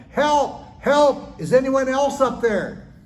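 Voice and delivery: high, loud panicked yell for help. Acted. Jack rejects God's advice and yells for anyone else, panicked shout. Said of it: "Jack yelled".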